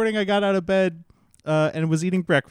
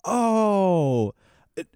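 flustered refusal; 3 semitones higher, much slower than usual. Retold or imitated. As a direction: re-enact backpedaling awkwardly after a refusal. Re-enacts flustered response verbatim.

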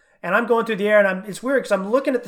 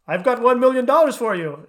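louder, higher scammer pitch; scam call voice; 5 semitones higher, 7 dB louder than usual. Retold or imitated. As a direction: ham up the phone scammer. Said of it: "he sounds completely like".

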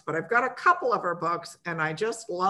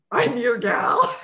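laughing; 5 dB louder, slower than usual. Real, not acted. Host jokes about herself.

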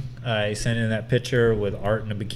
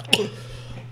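gasp; 5 dB quieter than usual. Retold or imitated. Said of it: "and I'm like".

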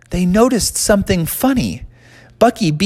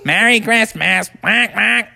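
cartoon duck voice, slightly raised; quacking duck; 4 semitones higher, slower than usual. Duck at the front of the sleigh, quacking voice. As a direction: squawk it like a duck. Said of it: "a duck in front going".

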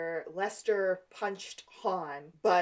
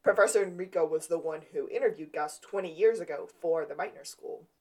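usual speaking voice

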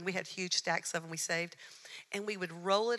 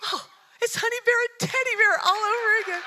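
high, loud, tearful exclamation; delighted cry; 14 semitones higher, 11 dB louder than usual. Retold or imitated. Realizing the friends echo her old collies: high, loud, delighted tearful cry. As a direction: burst out in happy recognition. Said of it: "it just touched my heart".

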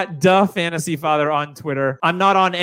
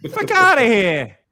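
put-on Boston accent; 5 semitones higher, slower than usual. Boston bit continues.

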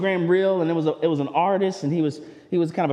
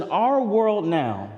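ordinary talk, nothing marked

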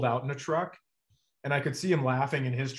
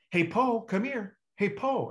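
higher, light sing-song call; calling a dog; 7 semitones higher, slower than usual. Retold or imitated. Lady calling her dog.

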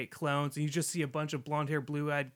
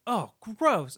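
put-on high, loud kid's "ew" reaction; playfully repulsed, raised voice; mock disgust; 6 semitones higher, 7 dB louder than usual. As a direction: exclaim it like a grossed-out kid. Acting out a kid's disgusted reply.

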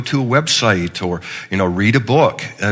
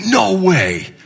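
amazed; 8 semitones higher than usual. Retold or imitated. Uber driver amazed at pastor.

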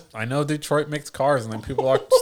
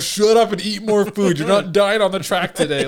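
drill instructor; 9 semitones higher, 6 dB louder than usual. Retold or imitated. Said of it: "my R D C would be like".

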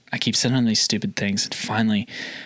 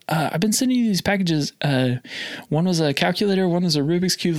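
retold sales pitch in a raised voice; high nervous voice; 7 semitones higher than usual. Salesman retelling how he explained his mailed gifts, high-pitched. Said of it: "I'm sure my voice got, like, so high".

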